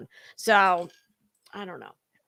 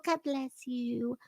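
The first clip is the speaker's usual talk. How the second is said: mock pious blessing; 11 dB quieter, slower than usual. Mocking a fake pious blessing.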